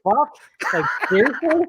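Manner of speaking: high, incredulous mock outrage; Superman's disbelief. Host acts out Superman threatened by Batman, high mock incredulity.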